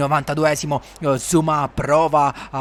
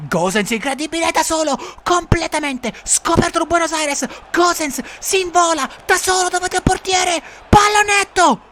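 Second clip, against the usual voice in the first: rising, high, louder excitement; breathless italian goal commentary; 13 semitones higher, 3 dB louder than usual. Acted. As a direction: build to the chip shot. Chance building.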